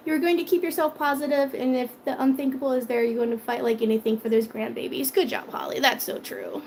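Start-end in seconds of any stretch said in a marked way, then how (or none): none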